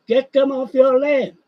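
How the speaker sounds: "Get them off your land" pushed up and out, stress on "them"; emphatic repeat. Real, not acted. Ranting repeat, louder second take.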